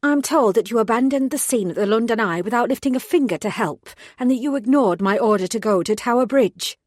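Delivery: loud, clipped reprimand; angry scolding. Acted. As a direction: tear a strip off the junior officer. Angry boss scolding on phone.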